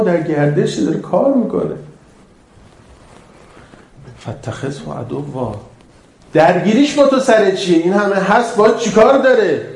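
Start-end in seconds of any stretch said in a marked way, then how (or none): 4.2-5.5 slow, much softer Quran verse; hushed recitation. Acted. Preacher quoting the verse to the hall, hushed. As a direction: let the verse sink in quietly. Quiet recitation after fervour.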